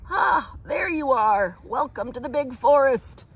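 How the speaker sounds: loud, slowed hearty welcome; calling out. Acted. Old Father Slipslapperlack welcoming the Root children, calling out. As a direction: call across the forest to greet them. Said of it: "he called to the children".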